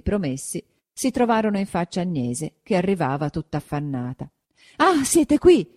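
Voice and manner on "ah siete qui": high, loud gasp of relief; a mother out of breath from running; panting exclamation; breathless relief. A narrator's reading. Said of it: "cavando fuori la parola a stento".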